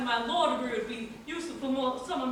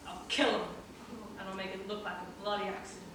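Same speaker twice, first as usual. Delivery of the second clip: quiet menace; 7 semitones lower, 8 dB quieter than usual. Acted. Threat drops to menace.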